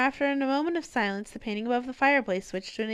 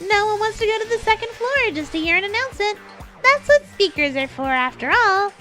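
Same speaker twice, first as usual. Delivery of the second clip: high, loud, hyped announcer voice; fighting game announcer; 9 semitones higher, 6 dB louder than usual. Acted. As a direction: boom it out like a show host. Announcer voice in story.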